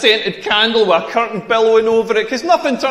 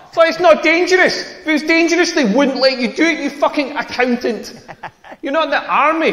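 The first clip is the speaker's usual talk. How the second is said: pitched-up incredulous ranting; exasperated rant; 6 semitones higher than usual. Real, not acted. Comic ranting at his Facebook friend, incredulous outburst. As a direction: scoff at him, higher and building.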